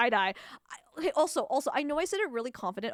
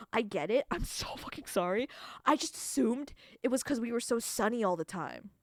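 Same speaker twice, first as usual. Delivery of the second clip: usual speaking voice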